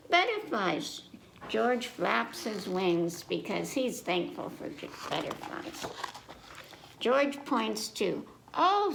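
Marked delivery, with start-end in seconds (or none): 0.1-1.0 higher excited mommy; Mommy Pig voice. Acted. Mommy Pig showing George butterflies, excited. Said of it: "said Mom, um, Mommy Pig". 8.5-8.9 high, louder surprised gasp; sudden delighted discovery. Acted. Peppa spotting spiders, high surprised oh. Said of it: "says Peppa".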